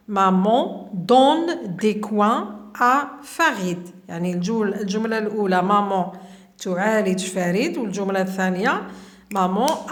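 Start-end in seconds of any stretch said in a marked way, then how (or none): 0.1-3.9 very slow, each word set apart; slow reading. Shown as a demonstration. Teacher reading the second sentence to pupils, very slowly. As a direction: spell it out slowly for dictation.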